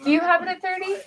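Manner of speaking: calling out bids to the room; auctioneer call. Acted. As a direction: ask for the next bid like an auctioneer.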